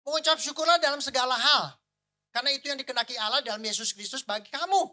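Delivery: forceful, high-pitched Bible recitation; proclaiming Scripture. Acted. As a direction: declare God's word to the congregation. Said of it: "Firman Tuhan kan katakan begini".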